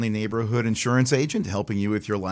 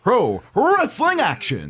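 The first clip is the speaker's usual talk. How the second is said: booming, drawn-out ring announcer call; wrestling ring announcer voice; 12 semitones higher, 6 dB louder, much slower than usual. Shown as a demonstration. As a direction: announce it like a main-event introduction, high and loud. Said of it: "I'll give you the full announcer voice".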